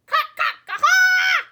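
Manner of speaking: high, loud cackling laughter; hen cackling. A narrator's reading. Hen cackling with laughter at the cow, high and loud. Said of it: "she cackled".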